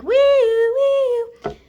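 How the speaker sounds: loud, high wailing siren noise; imitated alarm siren. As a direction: wail like a store alarm. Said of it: "this alarm sounds".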